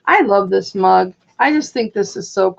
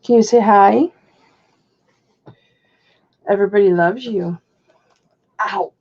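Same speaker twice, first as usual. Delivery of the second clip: talking to a dog. Real, not acted.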